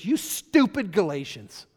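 loud, slow, emphatic scolding; scolding quote. Retold or imitated. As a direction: lean on each word like an exasperated apostle. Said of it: "he goes".

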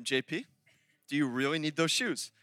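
low, measured question that falls at the end; quiet, pointed wifely check; patient second asking. Retold or imitated. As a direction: calmly press the question. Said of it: "She'll say a second time".

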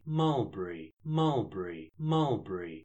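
steady repeated Mulberry, falling each time; mulberry chant. Acted. Actor doing crowd murmur for a sound effect, falling chant. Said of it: "I've got that effect".

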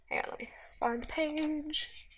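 quick unvoiced whisper aside. Real, not acted. Preacher whispers to himself while finding the page, whispered. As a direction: breathe it off-mic while searching.

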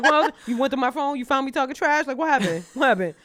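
loud, rushed nervous questions; panicked questioning. Pretend. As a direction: fire off guilty questions.

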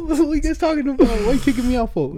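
high, loud hammed-up protest; indignant protest. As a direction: plead your case, outraged and high-pitched. Acts out protest at being kicked out.

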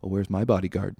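usual speaking voice

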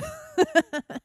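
high laugh; high-pitched giggle at own bit. Real, not acted. Laughs after giving permission.

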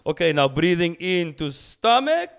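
put-on loud, high Dutch-accented coach; Wim Hof. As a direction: drive the lesson home with a Dutch accent. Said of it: "my favorite is when he's like".

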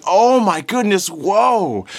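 high, loud crowd exclamation; cheering amazement. Acted. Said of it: "dozens of people cheering and saying".

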